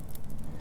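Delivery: a long soft sigh of giving in; deep sigh. Acted. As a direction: let the breath go before speaking. Emotional pause before confession.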